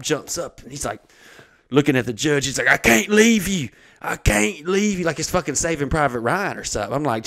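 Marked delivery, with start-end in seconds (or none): 2.7-5.0 high, slow, melodramatic cry; hammed-up war-film refusal to leave; mock-heroic plea.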